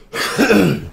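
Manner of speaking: a throaty cough with something stuck; real cough. Real, not acted. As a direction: clear a clogged throat with a cough. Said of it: "I got so much stuff in my throat".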